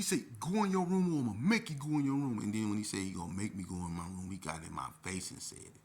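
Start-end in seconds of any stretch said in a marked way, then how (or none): 0.4-2.4 loud, high command; angry threat. Retold or imitated. Staffer threatening to force youth to room, angry. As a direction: shout it as a threat.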